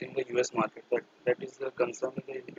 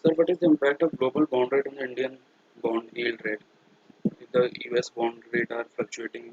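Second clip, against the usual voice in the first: raised voice to be heard; speaking up louder; 6 dB louder than usual. Real, not acted. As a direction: project the voice across the call. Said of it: "please be a little more louder".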